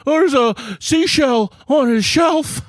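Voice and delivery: high, slow, hammed-up panic; mock panicked vamping. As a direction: act out floundering for something to say. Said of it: "I just start going".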